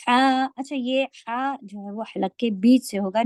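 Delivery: ordinary talk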